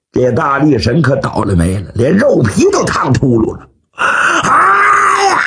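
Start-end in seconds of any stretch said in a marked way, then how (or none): 3.9-5.4 high, drawn-out yelp of pain; scalded scream. Acted. Strongman with hot soup dumped on his head, screaming. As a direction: cry out high and long.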